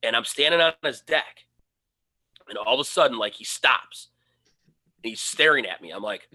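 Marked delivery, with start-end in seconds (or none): none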